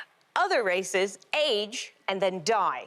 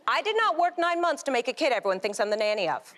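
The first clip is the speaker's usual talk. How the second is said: ordinary talk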